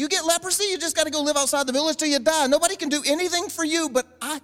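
high-pitched, incredulous scolding; worked-up, reproachful rant; exasperated Jesus. Acted. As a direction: rattle off reminders in high disbelief. Acted exasperated Jesus continues scolding.

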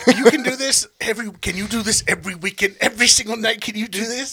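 high, excited pitch voice; hammed-up eager manager; imitating a pushy boss. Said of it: "You were like".

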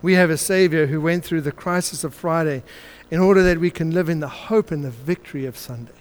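normal talking voice

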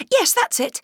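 put-on very high, relieved little girl; happy girl. Girl to the kind stranger, high and delighted. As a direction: answer brightly, delighted.

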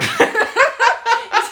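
loud high burst of laughter. Real, not acted. Friend retelling the film, bursting into high laughter. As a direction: crack up at the memory.

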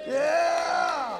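loud, high drawn-out cheer; big high "yeah!"; cheering shout. Real, not acted. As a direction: whoop it out for them. Cheering someone who came up.

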